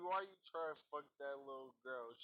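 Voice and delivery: ordinary talk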